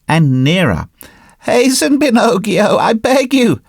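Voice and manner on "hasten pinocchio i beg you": high, urgent pleading goat voice. Acted. Little goat on the rock urging Pinocchio on, high and pleading. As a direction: beg him to hurry in a high bleating plea. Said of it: "bleated the little goat".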